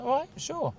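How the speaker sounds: way up high, lightly agreeing; hammed-up cheerful yes; mock agreeable. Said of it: "And I was like".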